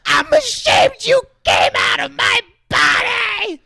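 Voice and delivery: very high, loud, slow shriek; hammed-up wailing mom; mock shrieking mother. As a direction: shriek it back at your son. Voicing the mother's retort.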